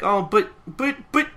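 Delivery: flustered protest. Retold or imitated. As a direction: sputter a protest, caught off guard. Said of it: "Tatsumi's like".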